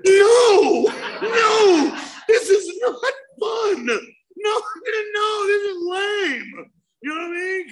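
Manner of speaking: high, loud, laughing no-no-no; outraged laughing yell. Retold or imitated. At the bonfire with the s'mores guy, laughing outraged yell. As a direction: shout it, half laughing, half pained.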